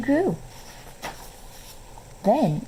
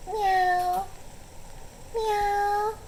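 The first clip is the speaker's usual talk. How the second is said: high mewing meow meow; cat meowing; 13 semitones higher, 4 dB quieter than usual. A narrator's reading. Ringo calls Carol to come outside with a high meow. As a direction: mew like a small cat calling.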